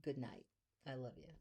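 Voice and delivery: soft loving goodnight; tender goodnight. Real, not acted. Bedtime goodbye to listener.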